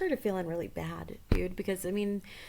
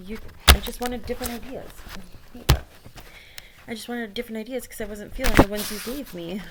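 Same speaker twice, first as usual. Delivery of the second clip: shout; 2 semitones higher, 8 dB louder than usual. Retold or imitated.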